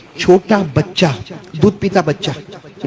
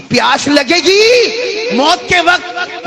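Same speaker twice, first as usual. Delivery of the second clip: dramatic warning; much slower than usual. Real, not acted.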